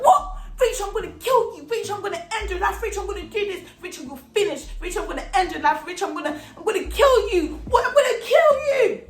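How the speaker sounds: high-pitched, frantic acted rant; enraged threats. Classmate charging at Rachel to fight, enraged.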